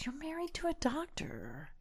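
hushed, high-pitched disbelief; condescending attorney. Retold or imitated. Female attorney to unhappy client, condescending.

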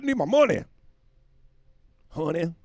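high, loud mock outburst; mad Tom Cruise. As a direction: shout it as a worked-up star demanding pay. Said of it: "little Tommy Cruise is mad".

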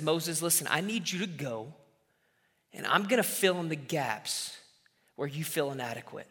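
God comforting a fearful Moses; tender reassurance. Retold or imitated. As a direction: reassure him quietly.

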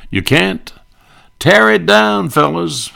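very high, loud Colonna squawk; Colonna punchline. Retold or imitated. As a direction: yelp it way up high, then call to the crew.

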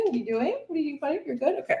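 soft, hushed check-in with the dog; quiet aside to a pet; talking to the dog. Real, not acted. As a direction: murmur gently to your buddy.

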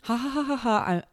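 breezy brush-off laugh; mock laugh. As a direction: laugh the dagger off lightly. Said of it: "I'm just like".

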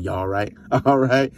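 normal talking voice

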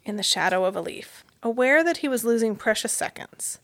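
ordinary delivery, nothing marked

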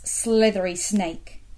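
ordinary delivery, nothing marked

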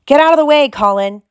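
loud, high irritated order; sharp burst of anger, easing on the name; angry. A narrator's reading. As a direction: shout him out of the way. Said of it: "said Harry angrily".

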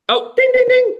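high chiming ding; buzzer ding. Pretend. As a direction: ring in like a buzzer. Buzzing in on quiz show.

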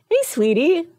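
high sweet call. Retold or imitated. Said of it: "I'm like".

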